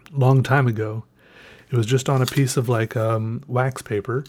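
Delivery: ordinary talk, nothing marked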